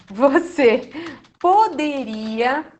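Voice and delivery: slow, drawn-out polite question; polite request. Shown as a demonstration. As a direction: stretch poderia to sound courteous. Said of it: "ao invés de eu falar". (pedido educado, com poderia bem alongado)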